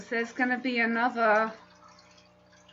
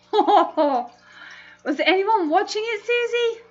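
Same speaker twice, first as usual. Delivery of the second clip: real amused laughing; 9 semitones higher, 4 dB louder, slower than usual. Real, not acted. Streamer to viewer about a funny mishap, laughing. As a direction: gasp of laughter into a high question. Cracks up at Susie's funny story.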